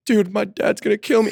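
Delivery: high, whiny about-to-cry voice; mock teary kid after a crash; imitating a tearful kid. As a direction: play the big kid near tears. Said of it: "he starts, like, crying".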